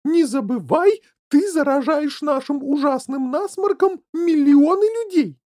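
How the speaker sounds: loud, high motherly tirade; angry scolding. A narrator's reading. Mother warning son about his cold, angry. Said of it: "гневно нахмурилась миссис Глуп".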